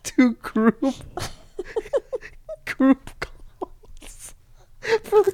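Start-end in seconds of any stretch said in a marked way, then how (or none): none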